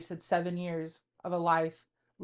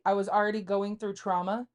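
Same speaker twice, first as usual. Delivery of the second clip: normal talking voice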